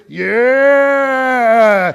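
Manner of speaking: long loud whoop; triumphant yell. Retold or imitated. Show-off guy on the bar whoops at the crowd, loud and long. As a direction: bellow it like a show-off on top of the world. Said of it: "he's like".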